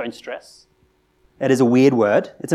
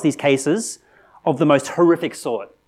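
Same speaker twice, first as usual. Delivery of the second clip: ordinary talk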